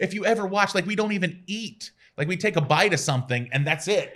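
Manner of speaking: high, pleading, put-upon voice; exasperated protest. Retold or imitated. To critics blaming the food podcast, high exasperated protest. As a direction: insist we barely eat, all exasperated.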